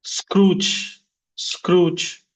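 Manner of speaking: Scrooge said as one stressed syllable; one strong syllable. Shown as a demonstration. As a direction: punch the single syllable of Scrooge. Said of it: "only one syllable".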